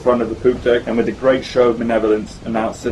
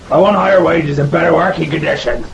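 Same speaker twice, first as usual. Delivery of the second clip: higher, loud sailor demand; crying out; 6 semitones higher, 4 dB louder than usual. Acted. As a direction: seaman shouting at the officers. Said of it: "cried the able seaman".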